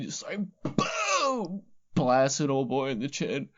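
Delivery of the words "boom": cannon boom. Retold or imitated. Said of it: "picturing this chick with a fucking cannon".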